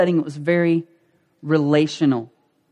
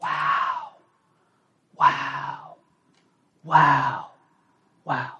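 breathy whispered awe; acted speechless wonder, with long pauses; stunned realization; 6 semitones lower, 6 dB quieter, much slower than usual. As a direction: let each wow out as a hushed breath. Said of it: "you can just sense them going".

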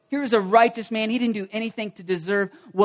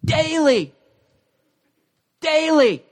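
"Daily" hit higher each time, then left in the pause; stressing daily; 7 semitones higher, 4 dB louder, slower than usual. Shown as a demonstration. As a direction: land "Daily" twice with a long pause between. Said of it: "It tells us how frequently".

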